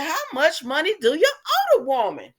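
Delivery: loud, high-pitched incredulous demand; mock exasperation. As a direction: exclaim it high and loud in disbelief. Acts out exasperation at Gladys.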